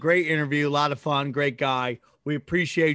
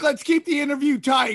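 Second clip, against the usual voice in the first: mock quote; 9 semitones higher than usual. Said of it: "I love how you said".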